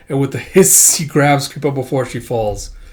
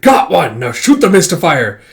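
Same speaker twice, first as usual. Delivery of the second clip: loud, raised-pitch battle call; shouting; 4 semitones higher, 7 dB louder than usual. Acted. A fighter orders a shot in battle, shouting. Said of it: "throat's getting dry from all the shouting".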